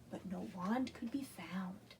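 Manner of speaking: hushed whisper; storyteller's suspense whisper. A narrator's reading.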